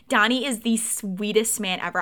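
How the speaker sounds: ordinary delivery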